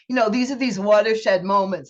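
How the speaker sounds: ordinary talk, nothing marked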